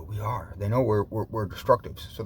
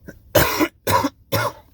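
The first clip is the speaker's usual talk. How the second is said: cough; 10 dB louder than usual. Real, not acted. Smoker coughing mid-talk.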